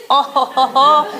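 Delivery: ordinary talk, nothing marked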